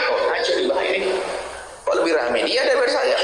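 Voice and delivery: jealous ustaz mocked. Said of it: "Apalagi mulai rame".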